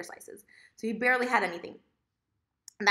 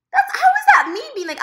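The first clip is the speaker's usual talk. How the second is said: loud, very high-pitched objection; incredulous protest; 13 dB louder than usual. Real, not acted. Rejecting his blame for ordering, loudly incredulous. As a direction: hammer the question out high and loud.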